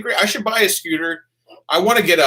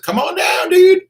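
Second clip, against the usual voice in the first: come on down call; 8 dB louder than usual. Real, not acted. Inviting a fan to visit.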